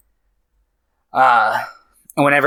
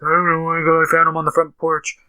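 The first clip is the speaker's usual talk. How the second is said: higher mock-child mumble, muffled; muffled kid; 6 semitones higher than usual. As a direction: imitate a kid talking into his hands. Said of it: "mashing both of his hands into his face".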